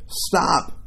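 breathy high cry of stop; a hint of the witch crying out; mock cry. A narrator's reading. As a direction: cut in sharply, barely voiced. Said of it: "cried the witch".

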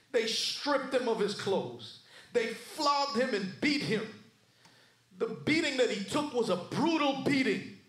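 forceful pulpit storytelling, leaning on key words; impassioned preaching. Real, not acted. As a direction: preach each indignity with rising force.

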